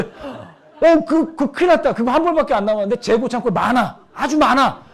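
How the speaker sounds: loud, high, hammed-up panic; salesperson's fake alarm. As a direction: sell it with exaggerated urgency. Salesperson's fake alarm over last item. (큰일 난 척 호들갑스럽게)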